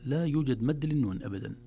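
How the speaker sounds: ordinary talk, nothing marked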